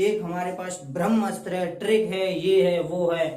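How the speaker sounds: loud, hyped-up imitation of a boast; mock boasting. Rival teachers show off their secret trick, mock boast. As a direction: build it up like a trick seller's pitch.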